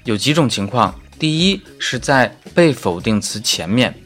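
ordinary talk, nothing marked

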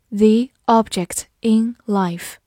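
slow, word by word; slow reading. Shown as a demonstration. Teacher repeating a phrase for learners, slow reading. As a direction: space out each word for learners.

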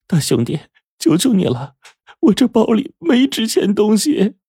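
high-pitched woman begging piteously; pitiful pleading. A narrator's reading. Middle-aged woman begging a robber to leave her bag, pitiful. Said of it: "中年妇女可怜兮兮地说".

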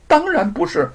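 high, sharp denial; indignant wife. Acted. Wife rejects husband's accusation, indignant high denial. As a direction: deny it flat out. 玉凤 denies.

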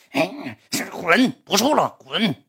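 imitating a furious breakup outburst; angry dismissal. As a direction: spit out a retold rejection. Retold breakup fight in internet cafe.

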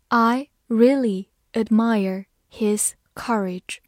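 slow clear reading of I really admire his courage; slow model reading. Shown as a demonstration. Teacher to students, slowly modelling the sentence. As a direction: read it slowly for the class to follow. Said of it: "造个句子".

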